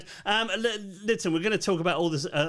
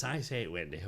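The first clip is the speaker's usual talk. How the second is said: low, put-on English accent; mock an awful English father's voice, low and soft; Mister Darling; 8 semitones lower, 6 dB quieter than usual. Actor recalling his awful English role, English accent. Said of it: "when I did Mister Darling that spoke like this".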